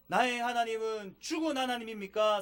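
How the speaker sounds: ordinary delivery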